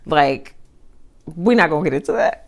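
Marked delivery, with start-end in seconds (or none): none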